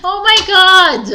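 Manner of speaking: loud drawn-out groan of oh my god; slow, loud, fed-up cry; exasperated shout. Real, not acted. Reader yelling at her own bloopers.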